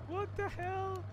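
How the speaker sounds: surprised. Real, not acted. Shocked by flood of donations.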